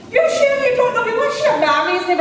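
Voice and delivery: very high, loud Coatbridge screech; Coatbridge accent. Retold or imitated. Caller quizzing the receptionist, loud high Coatbridge accent. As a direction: imitate a Coatbridge caller at full pitch. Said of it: "in her best Coatbridge accent".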